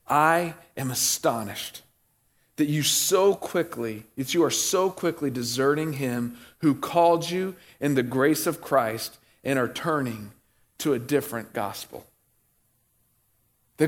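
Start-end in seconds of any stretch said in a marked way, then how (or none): 0.1-12.1 scripture reading. Acted. Reads scripture slowly.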